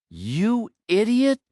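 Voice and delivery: high, slow, furious shriek; furious scream. A narrator's reading. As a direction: scream the insult out, drawn out. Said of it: "he screamed".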